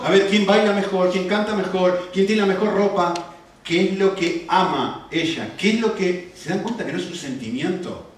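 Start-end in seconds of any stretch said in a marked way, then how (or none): none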